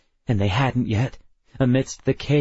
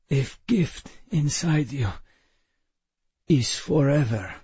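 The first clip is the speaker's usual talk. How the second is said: put-on weak Russian accent; 3 semitones higher than usual. A narrator's reading.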